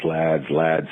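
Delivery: ordinary delivery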